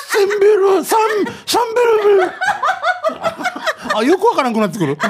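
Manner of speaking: high-pitched laughter through words; can barely talk for laughing. Real, not acted. Laughing while retelling drinking night.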